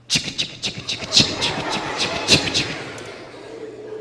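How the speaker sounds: whispered chicka chicka like a train engine; train chugging sound. Retold or imitated. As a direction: run like a steam train, breathy and rhythmic. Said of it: "That's how he runs".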